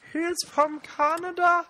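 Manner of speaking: high, slow put-on singer impression. Shown as a demonstration. Telling a mate where someone's from, high impression voice. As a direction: draw it out high in a mock celebrity voice.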